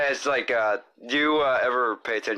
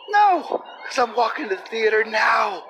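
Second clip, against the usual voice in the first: high, loud sudden shout; 11 semitones higher, 5 dB louder than usual. Acted. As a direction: burst out yelling.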